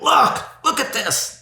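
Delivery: high, upset "Look, look at this"; exasperated look-at-this. Shown as a demonstration. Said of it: "actually indicates this emphatic emotion".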